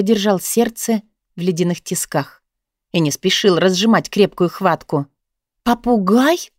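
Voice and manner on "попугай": ПОПУГАЙ as an echo question, rising at the end; surprised question. A narrator's reading. Guest reacts to learning of the bird, surprised question. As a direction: repeat ПОПУГАЙ back in surprise, pitch climbing.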